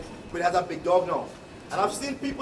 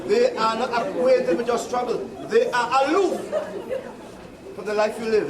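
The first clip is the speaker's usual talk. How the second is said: laughing emphasis; 4 semitones higher, 4 dB louder than usual. Real, not acted. Repeating the point, laughing.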